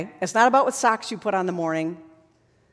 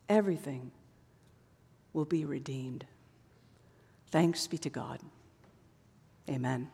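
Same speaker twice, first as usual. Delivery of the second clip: whispered, much softer; hushed whisper; 8 semitones lower, 12 dB quieter than usual. Real, not acted. Preacher closing sermon to congregation, whispered. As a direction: let it fall to a whisper.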